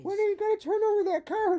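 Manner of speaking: high, fast squeaky whine; mock whiny complaint. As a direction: whine it like a cartoon kid. Playful jab at the tarot reader.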